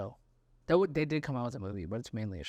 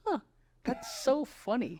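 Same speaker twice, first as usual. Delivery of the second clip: put-on amused kid; 11 semitones higher, slower than usual. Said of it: "you're like".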